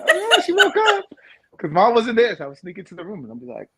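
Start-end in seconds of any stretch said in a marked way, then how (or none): 0.0-1.1 put-on eager kid voice. Imitating himself as eager kid.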